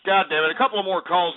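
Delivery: usual speaking voice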